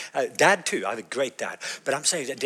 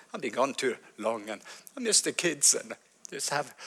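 much softer, breaking voice to his wife; softly weeping; 8 dB quieter than usual. Retold or imitated. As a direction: let the words fade into tears. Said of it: "kind of wept".

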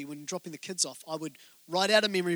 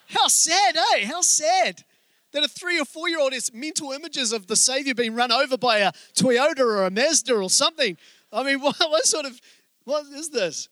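amused disbelief; 6 semitones higher, 5 dB louder than usual. Real, not acted. Laughs at son's car gospel.

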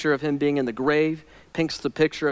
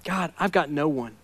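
much softer, low, hushed; quiet lament; 3 semitones lower, 6 dB quieter than usual. Shown as a demonstration. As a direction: say it lonely and small. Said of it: "what he's saying is".

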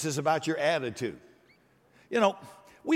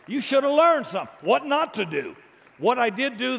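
sudden loud, higher shout; preaching shout; 7 semitones higher, 5 dB louder than usual. Real, not acted. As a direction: hammer it at the congregation. Preacher driving the point home.

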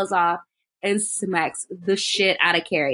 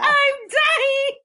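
mock panic, high drawn-out wail; hammed-up freak-out, pitched way up; Carrie. Retelling Carrie's panic in the shower, high mock wail. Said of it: "she freaks out".

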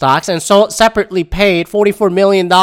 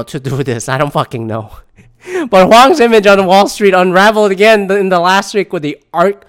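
ordinary talk, nothing marked

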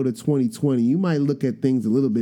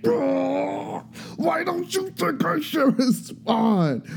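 incredulous whine; 14 semitones higher, much slower than usual. Retold or imitated. Incredulous quote to his wife.

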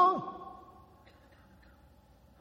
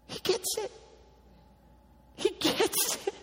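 high, hushed, excited he gets it; lightly voiced thrilled Jesus; a hint of Jesus bursting with joy; 10 semitones higher, 8 dB quieter than usual. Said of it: "He's so excited".

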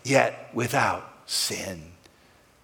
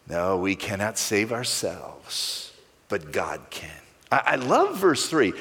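normal talking voice

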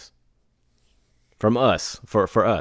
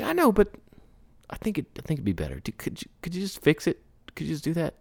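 wheedling client; 10 semitones higher, 3 dB quieter, faster than usual. Retold or imitated.

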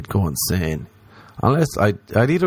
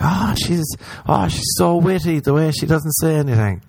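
mock dazzled admirer; 7 semitones higher than usual.

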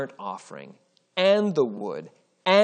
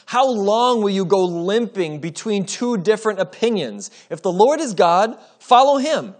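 higher and louder, rising to a ringing call; challenging cry; 7 semitones higher, 6 dB louder than usual. Acted. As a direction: throw the challenge at the crowd. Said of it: "he challenged them".

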